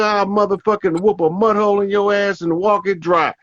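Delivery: imitating a scolding parent, high-pitched; hammed-up, high-pitched parental tirade; mock aggression. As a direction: rattle off threats like an angry elder. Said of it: "immediate aggression".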